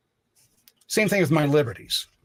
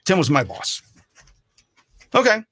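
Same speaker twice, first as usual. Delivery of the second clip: normal talking voice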